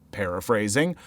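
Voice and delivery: ordinary talk, nothing marked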